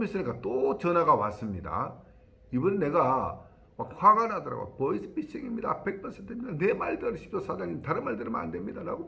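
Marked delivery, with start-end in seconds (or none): 4.8-9.1 high, pressing bank clerk urging; urgent bank warning. Retold or imitated. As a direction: rush the warning, pushing hard. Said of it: "막 이야기하더라고요". (다급하게 재촉하는 은행원 목소리)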